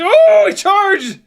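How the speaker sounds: high, loud whoop of surprise; excited shriek. Real, not acted. Dog charges the drone, thrilled shout.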